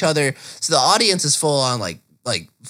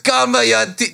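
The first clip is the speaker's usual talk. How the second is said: high-pitched mock-French call; exaggerated French director voice; French accent; 8 semitones higher than usual. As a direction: copy a friend's French accent, hamming it up. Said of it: "French accent".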